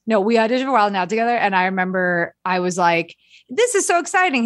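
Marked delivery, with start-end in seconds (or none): 3.5-4.4 high-pitched giddy quote of a past self; excited gushing. Retold or imitated. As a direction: gush in a much higher voice. Recalls own gushing at audition.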